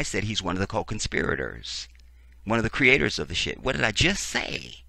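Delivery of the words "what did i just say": exasperated. Real, not acted. Exasperated at the article again.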